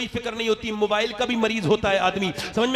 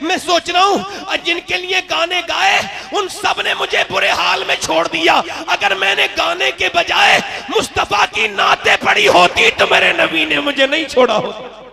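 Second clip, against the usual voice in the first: higher and louder, shouting the sermon; fiery preaching; 7 semitones higher, 10 dB louder than usual. Acted. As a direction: thunder it out to the crowd. Preaching at full force.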